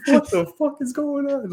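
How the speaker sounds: high, incredulous exclamation; mock disbelief. Player baffled by the rookie's play, high mock disbelief. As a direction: act baffled by what you just saw. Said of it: "he's like".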